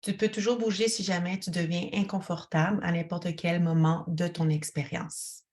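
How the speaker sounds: ordinary talk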